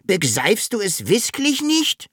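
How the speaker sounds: high, pleading little dragon; desperate. A narrator's reading. Dragon failing to be understood, high desperate question. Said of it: "Der Drache war ganz verzweifelt".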